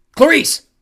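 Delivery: high, very loud call; full-volume shout to a friend; urgent yell. A narrator's reading. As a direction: yell the name to break through panic. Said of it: "I yelled".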